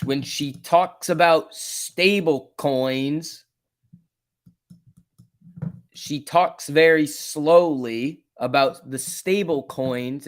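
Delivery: very slow, random stress on syllables; Janet Yellen. Acted. Streamer mocking how she talks about stable coins, slow impression. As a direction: drag it out with oddly placed emphasis. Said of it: "that's my impression of Janet Yellen".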